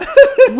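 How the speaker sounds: loud high laugh at the memory; sudden giggle burst. Real, not acted. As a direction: break out laughing.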